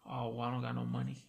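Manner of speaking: quietly telling him she's broke; whispered. Retold or imitated. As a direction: breathe it out, almost ashamed. Girlfriend retold admitting no money.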